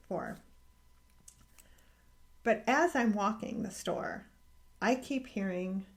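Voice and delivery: ordinary talk, nothing marked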